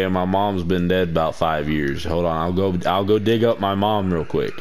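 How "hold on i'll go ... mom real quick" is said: normal talking voice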